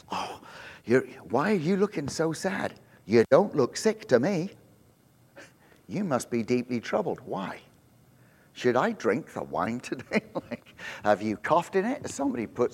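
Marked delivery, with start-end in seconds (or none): 0.1-10.2 soft, low, hushed questioning; hushed worried king. Retold or imitated. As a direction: lean in and murmur like a suspicious king. King quietly questions worried cupbearer.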